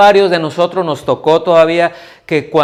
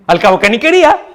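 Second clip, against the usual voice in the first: mock sulky child; 10 semitones higher, faster than usual.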